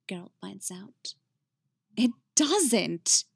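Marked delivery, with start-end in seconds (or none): 2.0-3.3 higher, louder emphatic contradiction; forceful, raised rebuttal; insistent. A narrator's reading. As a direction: insist firmly, punching the negative. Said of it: "Jaskier insists".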